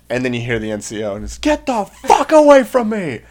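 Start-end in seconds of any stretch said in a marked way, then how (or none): 1.4-3.2 high, stretched-out furious yell; screaming corporal. Retold or imitated. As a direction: roar it to drive the recruit off. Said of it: "is just him screaming".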